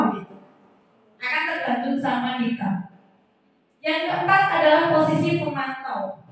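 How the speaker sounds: ordinary talk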